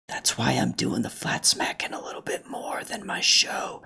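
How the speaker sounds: whispered aside. Real, not acted. Streamer confiding to chat about flat smacking, whispering.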